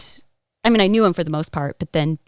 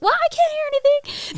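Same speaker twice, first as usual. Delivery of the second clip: very high, exaggerated retold voice; oblivious mock squeal; 4 dB louder than usual. Sleeper missing the pounding on the door, mock squeal. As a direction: squeak it out as comic self-mockery.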